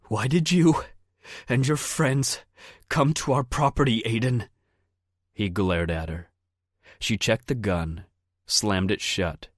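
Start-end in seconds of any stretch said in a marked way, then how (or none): 0.0-4.5 shouted interrogation of the intruder; near shout. A narrator's reading. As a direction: nearly shout the question at him. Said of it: "She nearly shouted the question".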